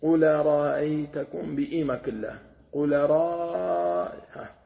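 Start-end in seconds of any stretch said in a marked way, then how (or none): 0.0-1.6 "قل رأيتكم" slow, the hamza replaced by a long alif; alif substitution demo. Shown as a demonstration. Teacher shows hamza replaced by alif, slow recitation. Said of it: "يعوض منها ألفا". 2.7-4.1 "قل را" with the a held very long; long alif demo. Shown as a demonstration. Teacher stretches the alif to show substitution, very drawn out. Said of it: "يعوض منها ألفا".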